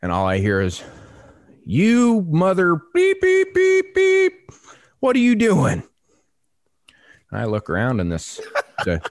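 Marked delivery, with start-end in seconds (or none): 1.6-5.8 very high, louder, drawn-out holler; mimicked bullhorn yelling. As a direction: imitate an irate woman hollering across a field. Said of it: "I thought she had a bullhorn".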